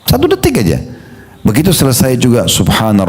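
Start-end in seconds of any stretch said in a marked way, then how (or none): 0.0-0.8 "Satu detik" thrown up very high; teacher insisting on the pause; stress on satu detik. Shown as a demonstration. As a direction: lift "Satu detik" up to drive it home. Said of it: "coba kasih jeda satu detik itu".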